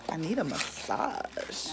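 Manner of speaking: ordinary delivery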